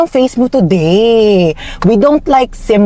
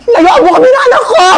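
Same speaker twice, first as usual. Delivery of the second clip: high, hammed-up yell at the phone; mock furious shout; 13 semitones higher, 4 dB louder than usual. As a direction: fake outrage, shrieking at the mother.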